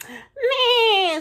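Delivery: high, loud, long whinny; horse's neigh. A narrator's reading. Horse greeting the truck, high loud neigh. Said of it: "said the horse".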